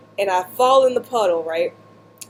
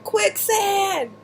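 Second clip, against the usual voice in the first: high-pitched call of "Quicksand!" to a friend far away; 12 semitones higher than usual. Retold or imitated. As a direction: yell the warning across the field, way up high. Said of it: "I call out to my friend".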